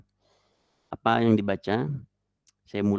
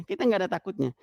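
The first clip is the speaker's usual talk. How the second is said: ordinary talk, nothing marked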